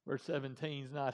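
ordinary delivery

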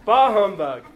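a loud hammed-up Scrooge scoff; playful loud Scrooge impression for the crowd; Scrooge grumble. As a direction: bark it like a grumpy old miser. Pastor mimics Scrooge after video.

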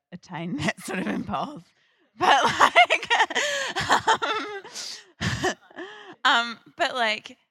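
giggling through the words; laughing. Real, not acted. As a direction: break into real laughter. Laughing at own aspiration.

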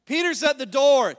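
loud, eager blurt; excited announcement. Acted. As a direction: burst in with the news. Acted quarrel at the gate.

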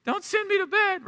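high-pitched mock wail of a small child; pleading child. Son begging dad at bedtime, melodramatic high plea. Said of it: "is like a knife to the heart to him".

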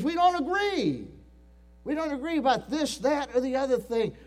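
high, loud whiny complaint; mock quarrelsome member. Mocking quarrelsome church members.

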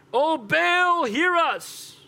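high, drawn-out plea; calling on Baal. Acted. Prophets to their god Baal, high pleading call. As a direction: cry up to a god. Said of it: "called the name of Baal".

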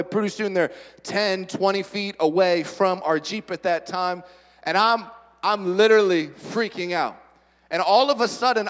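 ordinary talk

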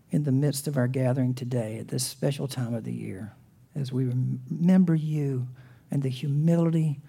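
soft, hushed prayer voice. Real, not acted. Pastor opening the sermon with a quiet prayer. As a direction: pray quietly and reverently.

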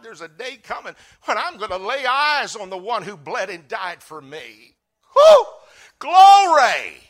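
very high, loud cry of joy; ecstatic shout. Real, not acted. Pastor shouting praise about seeing Jesus. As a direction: break into a shout of glory.

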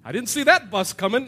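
loud, high, hammed-up exclamation; loud comic surprise; comic revived man. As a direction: blurt it out for laughs. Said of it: "what comes out of his mouth?".

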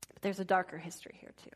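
fast hushed whisper; hint at darkness in a whisper; ominous whisper. Real, not acted. Telling viewers about Rome's dark past, hushed. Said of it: "ominous hush".

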